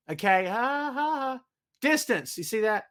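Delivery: ordinary delivery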